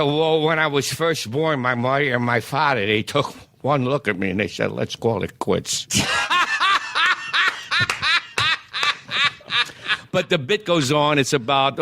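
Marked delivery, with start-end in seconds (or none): 0.0-5.9 put-on punch-drunk fighter. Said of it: "I'm talking like this". 5.9-10.1 high burst of real laughter; big high laugh. Real, not acted. Comedian cracking up at his own punchline, high laugh.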